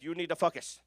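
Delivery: light imitation of a heavy Jamaican accent, focus said like "fuckus". Jamaican coach urging the room to focus, imitated Jamaican accent. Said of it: "a heavy Jamaican accent when he says focus".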